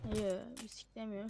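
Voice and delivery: ordinary talk, nothing marked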